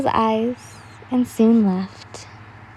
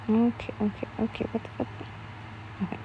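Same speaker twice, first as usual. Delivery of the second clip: under the breath, much softer; muttered aside; 13 dB quieter, faster than usual. Real, not acted. The reader breaks off to mutter at something, hushed. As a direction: mutter to yourself while reading. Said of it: "Sorry, I was reading something".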